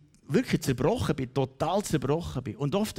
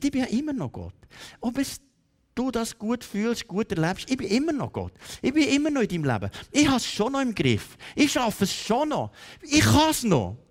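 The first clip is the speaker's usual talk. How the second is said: high-pitched, insistent, building in force; emphatic reassurance; 8 semitones higher than usual. Acted. God assuring a broken believer He still can, insistent. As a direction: press each promise home, growing more impassioned.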